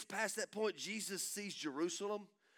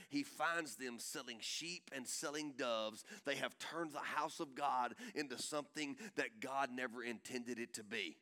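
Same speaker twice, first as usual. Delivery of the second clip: ordinary talk, nothing marked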